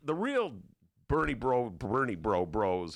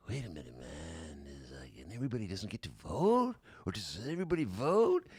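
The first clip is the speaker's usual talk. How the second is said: stoned guy voice; 5 semitones lower than usual. Retold or imitated. Imitating stoned bowler.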